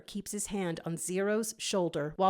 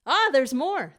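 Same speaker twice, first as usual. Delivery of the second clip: pleased; 6 semitones higher, 9 dB louder than usual. A narrator's reading. Finding another letter.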